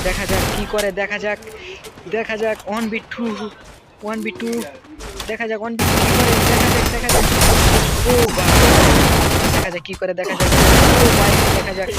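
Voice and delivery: building excitement as the fight peaks; excited. Real, not acted. Hyped calling as the fight peaks. (উত্তেজিত চিৎকার)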